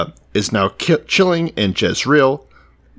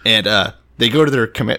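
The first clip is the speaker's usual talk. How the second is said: ordinary talk, nothing marked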